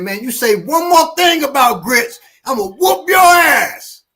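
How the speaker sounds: high, loud mock threat building to a shout; threatening passenger. Passenger threatening the grits guy, mock shout. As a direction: bark the threat back at him. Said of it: "somebody said".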